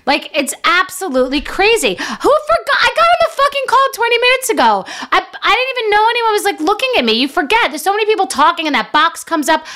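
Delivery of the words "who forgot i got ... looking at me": high, fast self-exasperation; worked up about her own mistake; exasperated. Real, not acted. Comedian rants high and fast about forgetting the camera.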